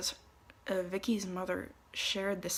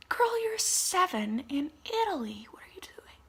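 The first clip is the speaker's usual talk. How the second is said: incredulous scolding; 10 semitones higher, 5 dB louder than usual. Retold or imitated. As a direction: playfully chide a swearing kid. Scolding swearing seven-year-old.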